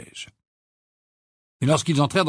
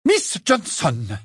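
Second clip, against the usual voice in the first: high, slow reprimanding call; stern raised-voice summons; 7 semitones higher, 4 dB louder, much slower than usual. A narrator's reading. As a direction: call the pupil to order, drawn out. Teacher calls out shouting pupil.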